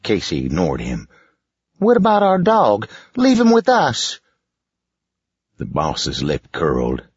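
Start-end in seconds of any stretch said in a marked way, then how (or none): 1.8-4.2 put-on high, loud child's voice; a kid begging for his dog; pleading child. Boy character voice.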